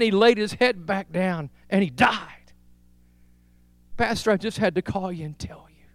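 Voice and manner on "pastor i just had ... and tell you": hushed, breathless whisper. Retold or imitated. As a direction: breathe it like a secret. Widow's hushed phone call.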